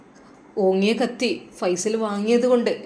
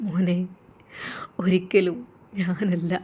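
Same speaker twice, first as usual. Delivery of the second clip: low breathy whisper; the frightened father whispering a denial; 4 semitones lower, 7 dB quieter, slower than usual. A narrator's reading. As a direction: whisper it, not daring to tell.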